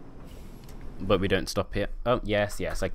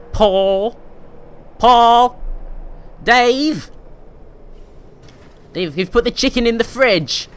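loud, high whiny British holler; whiny British accent; 10 dB louder, much slower than usual. Acted. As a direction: yell across the house in whiny British. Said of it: "the British accent is also quite whiny".

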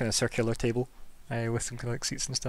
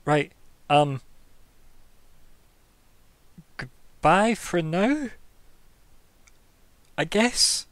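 high, slow, awkward goodbye; awkward boy voice; 5 semitones higher, 5 dB louder, much slower than usual. Acted. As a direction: drag it out, unsure and shy.

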